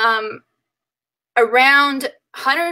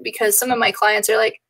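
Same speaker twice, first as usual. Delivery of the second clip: ordinary talk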